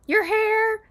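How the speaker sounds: high, loud mock gush; Monica. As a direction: exclaim it way up high, imitating her. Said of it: "Monica's like".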